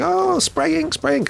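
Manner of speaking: put-on high excited child voice; imitating kids. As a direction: chirp it like an eager kid. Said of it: "they're there going".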